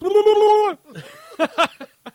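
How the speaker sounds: loud, high crazed boss giggle; over-the-top insane villain giggling; maddening giggle. Acted. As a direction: burst into a manic, drawn-out cackle. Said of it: "let me seal the deal with this maddening giggle".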